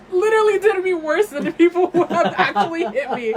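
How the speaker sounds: loud, high venting through laughter; laughing outburst. Real, not acted. Venting to a friend about the ex, laughing outburst. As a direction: exclaim it, half laughing at the hurt.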